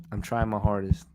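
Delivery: normal talking voice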